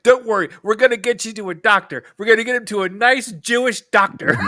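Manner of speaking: loud, high, hurried comfort; urgent loud reassurance. Retold or imitated. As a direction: shout it laughing. Quoting Avi urgently.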